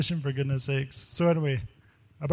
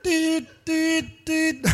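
much higher squeaky dude dude dude; excited mock alarm; 14 semitones higher, much slower than usual. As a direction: sell the mock panic, high and quick. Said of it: "I'm like".

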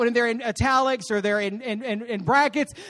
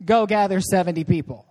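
low, matter-of-fact command; calm lower-pitched instruction; unmoved reply; 6 semitones lower than usual. Acted. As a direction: ignore the plea and calmly give orders. God answering, ignoring the wail.

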